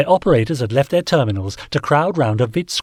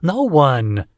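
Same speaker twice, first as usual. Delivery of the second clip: fed-up, louder no; exasperated declaration; 4 semitones higher, 5 dB louder than usual. A narrator's reading. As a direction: snap it out, sick of questions. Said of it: "Tinkler declared, exasperated".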